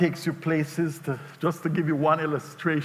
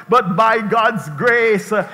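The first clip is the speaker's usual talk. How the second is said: ordinary delivery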